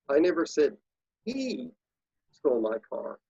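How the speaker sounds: pause, lift "he" way up, then drop; stress on HE. Shown as a demonstration. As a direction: put the emphasis on the word "he". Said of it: "say that sentence quickly seven different ways".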